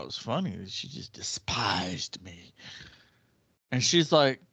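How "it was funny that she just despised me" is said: amused whispered aside. Real, not acted. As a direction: drop to a hushed whisper, amused. Amused aside about classmate.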